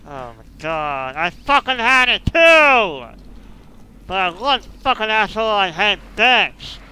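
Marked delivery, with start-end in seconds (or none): 1.1-3.0 high, loud outburst of frustration; angry complaint. Real, not acted. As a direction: shout it, cheated of the win. Lost a near win.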